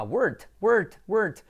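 "word" short and high, ending in T; imitated mispronunciation. Listeners correct the learner, mimicking word as wart. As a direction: mimic "word" coming out as "wert". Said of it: "estás diciendo verruga".

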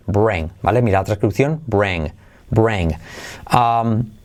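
usual speaking voice

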